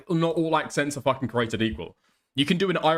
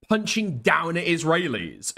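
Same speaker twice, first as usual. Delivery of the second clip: normal talking voice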